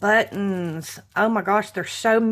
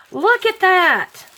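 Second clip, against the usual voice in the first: high, loud thrilled cry; excited; 10 semitones higher, 7 dB louder than usual. Real, not acted. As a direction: squeal it with delight. Excited at gift.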